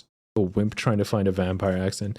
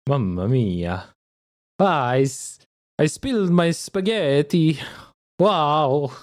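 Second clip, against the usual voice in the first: hammed-up, higher-pitched Italian accent; 6 semitones higher, slower than usual. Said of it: "Can you do Italian?".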